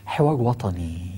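slow, low and soft, stressing حوار; slow emphasis. Shown as a demonstration. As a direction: lean on حوار and let وطني fall. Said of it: "حوار إيه ده؟".

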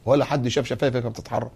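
ordinary talk, nothing marked

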